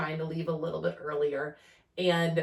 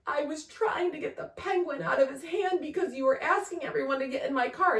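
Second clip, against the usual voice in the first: crying son; 10 semitones higher than usual. Retold or imitated. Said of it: "he was like".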